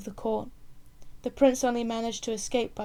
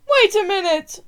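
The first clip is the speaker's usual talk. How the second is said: much higher and louder burst; sudden call; 10 semitones higher, 13 dB louder than usual. Acted. Old orange seller calling the prince back suddenly, a high loud burst. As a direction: stop him before he goes. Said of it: "said the man suddenly".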